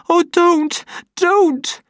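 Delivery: very high, loud begging; trembling plea. A narrator's reading. Lenina begging John while being shaken. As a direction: beg him to stop, shaking. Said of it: "she begged him in a voice which trembled".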